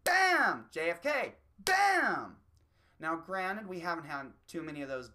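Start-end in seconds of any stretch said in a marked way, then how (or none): 0.0-0.7 gunshot. Retold or imitated. Mimicking the shot that killed [name], loud gunshot. Gunshot after naming assassinations. 1.6-2.4 gunshot. Retold or imitated. Mimicking the shot that killed [name], loud gunshot. Gunshot after naming assassinations.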